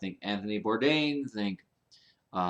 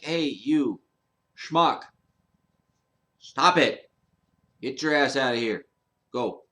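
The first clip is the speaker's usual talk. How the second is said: loud pretend scolding of rude strangers; imagined telling-off; 5 dB louder than usual. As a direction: chase the jerks off, mock fury. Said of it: "and been like".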